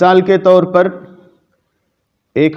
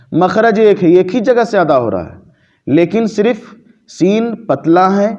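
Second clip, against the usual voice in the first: ordinary talk, nothing marked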